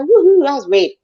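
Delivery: gleeful whoop from the porch; excited whoop. Real, not acted. Gleeful cheer about the chase.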